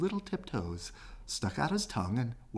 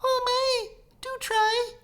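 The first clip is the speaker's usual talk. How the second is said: very high little fly voice; fly begging the elephant, very high; tiny fly; 4 dB louder than usual. A narrator's reading. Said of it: "said the fly".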